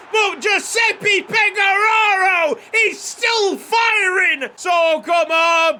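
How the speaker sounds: high, slow, shouting with joy; excited shout. Real, not acted. Cheering his striker who is still firing, excited shout. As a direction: belt it out in delight at the goal.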